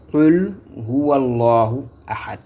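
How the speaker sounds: "Qul Huwallahu Ahad" slowly recited, long vowels; correct verse reading. Shown as a demonstration. Teacher recites the first verse as it must be read, slow "Qul Huwallahu Ahad". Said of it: "c'est ça qu'il faut lire".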